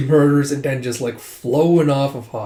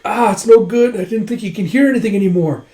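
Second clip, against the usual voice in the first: high, urgent retold worry; acted alarmed despair; 8 semitones higher, 5 dB louder, faster than usual. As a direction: give up calling in alarm. Comrades fail to reach Huck.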